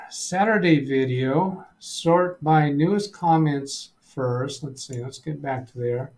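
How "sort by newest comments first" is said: ordinary talk, nothing marked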